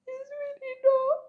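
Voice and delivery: high tearful insistence. Real, not acted. Emotional insistence.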